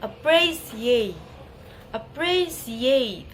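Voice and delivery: "appreciate" slow, with PRE emphasized; stress on second syllable. Shown as a demonstration. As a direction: lean slowly on PRE in "appreciate", lighter stress on ATE. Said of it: "primary stress in the second syllable".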